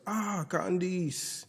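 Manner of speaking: put-on high, drawn-out French-accented greeting; mock French lilt, stretched out. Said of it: "with a French accent".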